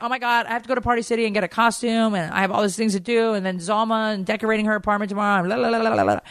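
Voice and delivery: rattled off fast and high, imitating a flustered daughter; breathless list of excuses, hammed up; mock overwhelmed babble; frantic rush.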